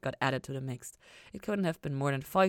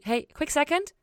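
high, louder call to stop him; 12 semitones higher, 5 dB louder than usual. A narrator's reading. Ella stopping Lucifer at the door, bright raised call. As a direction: catch him before he goes.

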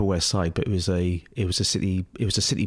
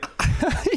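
giggle. Real, not acted. Laughing at memory.

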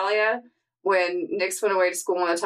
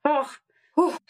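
hammed-up puffing after a climb; higher, pretend-exhausted complaint with a puff; mock winded; 5 semitones higher than usual. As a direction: play out of breath from the hill. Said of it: "So winded".

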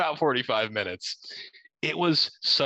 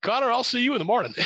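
fast, higher quick goodbye; hasty brush-off; 12 semitones higher, 4 dB louder, much faster than usual. Retold or imitated. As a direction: rattle it off and hang up. Owner hangs up fast.

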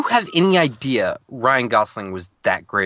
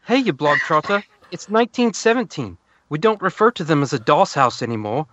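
higher-pitched, hammed-up Irish; put-on Irish accent; 4 semitones higher than usual. Podcaster reading a 1917 film letter to co-hosts, mock Irish accent. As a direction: read the letter as a comic Irishman. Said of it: "Andrew Scott plays an Irishman".

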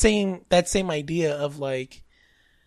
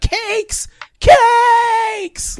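very high, hammed-up lament; mock sad wail; 7 dB louder than usual. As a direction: cry out as if grieving a lost love. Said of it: "Like, I was all sad".

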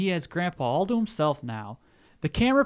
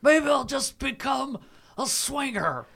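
high, slow whiny grandpa; sad old man voice; 10 semitones higher, slower than usual. Acted.

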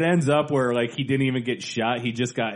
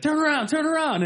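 much higher yelled heckle; mock heckler yell. Annoying guy at the theater yelling at the stage, high mock yell. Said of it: "the guy's yelling".

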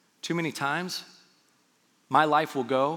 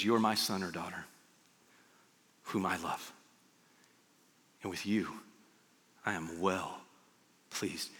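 very low and soft, long pauses; hushed, lingering fatherly affirmation; tender reassurance; 8 semitones lower, 10 dB quieter than usual. Acted. God looks at you, hushed tender affirmation.

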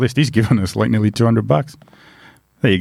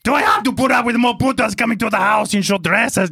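high, ranting mock Austrian accent; botched Italian sliding into a comic Arnold rant; Schwarzenegger accent; 12 semitones higher than usual. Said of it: "Now I'm Arnold Schwarzenegger".

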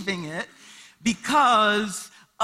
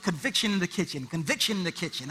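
softer, fast mumbled refrain; muttered to himself; 5 semitones lower, 6 dB quieter, faster than usual. Retold or imitated. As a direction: grumble it under your breath.

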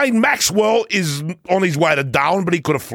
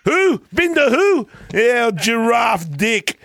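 mock taunt; 8 semitones higher than usual. Mocking rival rider.

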